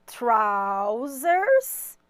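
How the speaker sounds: trousers são calças drawn out very slowly; deliberate slow model; slow teacher emphasis. Shown as a demonstration. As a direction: stretch each word for the class. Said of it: "Olha, agora".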